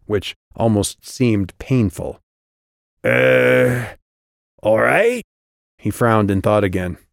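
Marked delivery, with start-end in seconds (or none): 3.0-5.2 loud, high goblin hesitation; unsure goblin in a raised voice; dim goblin guard. A narrator's reading. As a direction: puzzle it out like a slow-witted goblin. Goblin guard thinks it over.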